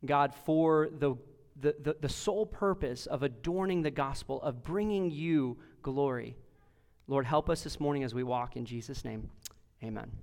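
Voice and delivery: low, soft, earnest praying voice; hushed pulpit prayer, falling away at phrase ends; opening prayer. Real, not acted. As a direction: pray humbly on behalf of the congregation.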